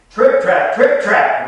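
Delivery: booming, heavy trip trap; loud trip trap. A narrator's reading. Preacher booming the big goat's heavy steps. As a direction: stamp out each word like heavy hooves.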